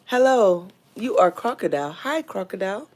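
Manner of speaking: ordinary talk, nothing marked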